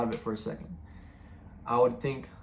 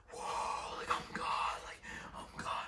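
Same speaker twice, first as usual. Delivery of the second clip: whispered mock scream, much softer than talk; imitated scream; 11 dB quieter, slower than usual. Retelling running round the neighborhood, whispered mock scream. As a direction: act out screaming with no voice. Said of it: "And I was just screaming".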